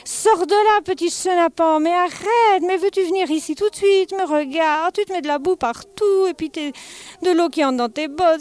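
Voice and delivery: mother yelling at her son in the puddle, shrill scolding shout. Acted. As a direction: call the muddy kid out of the puddle, fed up. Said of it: "sa maman qui criait".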